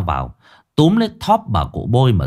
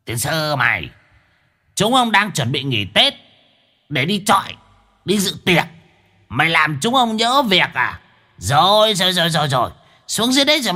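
high grumbling demon guard; a lazy demon cursing its ruined holiday; high gruff demon threat; 10 semitones higher than usual. Acted. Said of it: "văng vẳng vọng lên".

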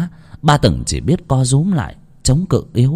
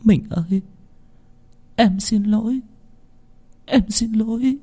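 much higher, softer sobbing apology; whimpering; 12 semitones higher, 4 dB quieter than usual. Acted. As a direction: whimper an apology through tears. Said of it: "mếu máo". (giọng mếu máo, cao và nhỏ)

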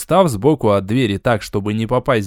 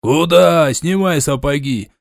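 loud sudden bark; 3 dB louder, slower than usual. Acted. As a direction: bark the word out like a gruff orc. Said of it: "тут же рявкнул".

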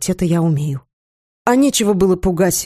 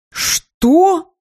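high roaring outburst; 11 semitones higher, 4 dB louder than usual. A narrator's reading. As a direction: roar it out in shock. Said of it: "взревел профессор Радомир".

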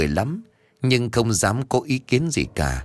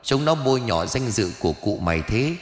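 usual speaking voice

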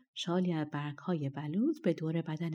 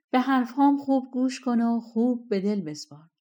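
raised, louder and higher call; shouting; 5 semitones higher, 5 dB louder than usual. A narrator's reading. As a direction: call out so he must listen. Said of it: "بعد فریاد زد". (فریاد بلند و زیر شبح)

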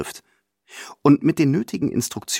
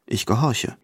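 usual speaking voice

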